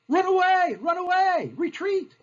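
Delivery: high, loud mock battlefield yell; panicked retreat cry. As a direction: call the retreat in a comic high shout. Voices Washington's panicked retreat cry.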